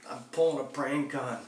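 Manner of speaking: high, slowed pleading to a friend; begging a friend to vouch before the cop. Retold or imitated. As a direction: beg him to back you up.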